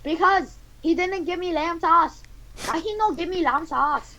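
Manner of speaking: high-pitched mock Chinese accent; cartoonish Chinese-accented complaint. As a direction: whine in a put-on Chinese voice. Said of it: "continuing with the Chinese voice".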